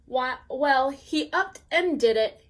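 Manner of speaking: usual speaking voice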